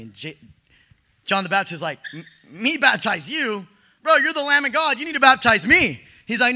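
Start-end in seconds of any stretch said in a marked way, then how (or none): 2.1-6.0 stammered disbelief rising into loud, high protest; incredulous protest. Retold or imitated. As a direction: balk at an absurd request, louder as you go. Acts out John's protest to Jesus.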